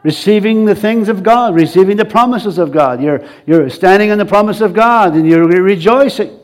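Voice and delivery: ordinary talk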